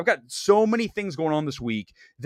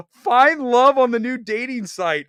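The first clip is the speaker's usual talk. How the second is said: mock spam ad; 6 semitones higher, 5 dB louder than usual. Shown as a demonstration. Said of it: "spam comments like".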